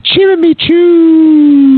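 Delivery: loud, high, drawn-out alien exclamation; Ewokese. Acted. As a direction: shout it like an Ewok, high and stretched. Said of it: "he starts out in Ewokese".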